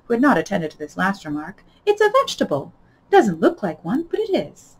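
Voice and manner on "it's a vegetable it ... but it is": excited. A narrator's reading. Alice exclaims answer.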